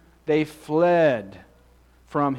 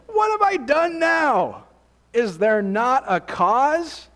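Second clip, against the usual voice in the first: high, loud, slow protest of innocence; indignant little brother shouting back; indignant outcry; 6 semitones higher, 4 dB louder, slower than usual. Retold or imitated. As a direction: cry out what have I done now, wounded. David protests his brother's rebuke.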